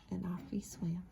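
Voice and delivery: soft storytelling whisper. A narrator's reading. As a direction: breathe it out as a secret. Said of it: "storytelling whisper".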